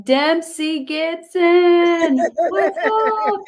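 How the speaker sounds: high, loud, drawn-out shout of greeting; excited call-out. Real, not acted. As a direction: holler hello to someone you love. Calls out to someone she loves.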